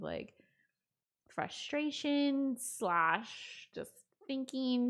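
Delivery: ordinary talk, nothing marked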